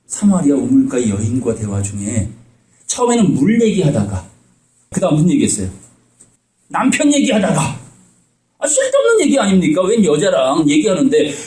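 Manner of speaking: usual speaking voice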